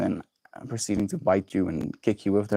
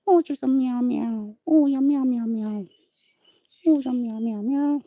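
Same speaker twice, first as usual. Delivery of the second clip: much higher cutesy cooing. Real, not acted. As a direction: coo at the cat in a tiny high voice. Cooing to the cat.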